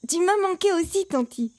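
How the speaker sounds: put-on high-pitched child's reply; affectionate little boy. A narrator's reading. Boy returning his aunt's affection, high child voice. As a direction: answer auntie in a high child voice.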